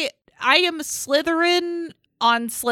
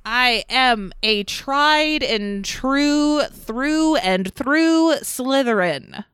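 slow, proud, emphatic; proud declaration; much slower than usual. Real, not acted. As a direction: declare it slowly.

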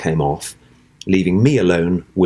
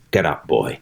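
aggressive stage order; 5 semitones lower than usual. Acted. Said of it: "I was meant to be very aggressive".

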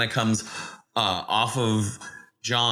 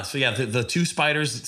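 ordinary talk, nothing marked

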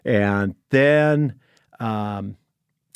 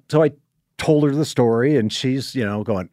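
normal talking voice

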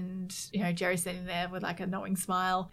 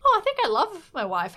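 high, louder voiced epiphany; mock dawning realization; 10 semitones higher, 8 dB louder than usual. Retelling Jerry's epiphany about his wife, mock sudden realization. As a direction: play a sudden revelation, pitched up.

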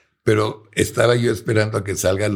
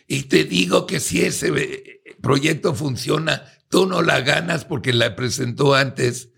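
angry client yelling, pitched much higher; retold yelling; 7 semitones higher than usual. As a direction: imitate the client shouting him down, voice well up. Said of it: "me empieza a gritar". (imitando al cliente que le grita, tono mucho más alto)

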